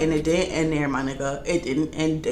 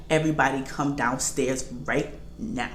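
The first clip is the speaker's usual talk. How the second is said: low, softer imitation of dad's order; lightly voiced stern dad; 6 semitones lower, 7 dB quieter than usual. Dad ordering the kids downstairs, lightly imitated stern and low. Father's order acted in story.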